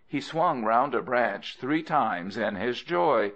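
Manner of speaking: ordinary delivery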